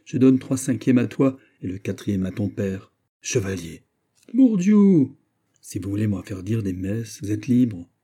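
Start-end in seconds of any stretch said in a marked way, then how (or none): 4.3-5.1 high, loud swearing that falls away; exclaimed oath. A narrator's reading. Musketeer swearing at his young friend, exclaiming loudly. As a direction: burst out with a high-pitched oath.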